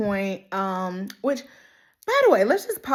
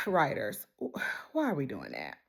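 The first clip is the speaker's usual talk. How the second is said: weary plea; 8 semitones lower, 11 dB quieter than usual. Real, not acted. Weary sigh at the writers.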